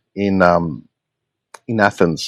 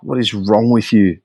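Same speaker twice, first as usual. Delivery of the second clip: ordinary talk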